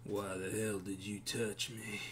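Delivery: lightly voiced grump at the bar; surly bar patron. Stranger at the bar resents being tapped, quietly surly.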